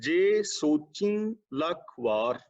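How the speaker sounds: very slow ਜੇ ਸੋਚੀ ਲੱਖ ਵਾਰ after the pause; slow, measured reading of ਜੇ ਸੋਚੀ ਲੱਖ ਵਾਰ; slow recitation after a pause. Shown as a demonstration. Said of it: "ਇੱਥੇ ਵਿਸ਼ਰਾਮ ਆਏਗਾ".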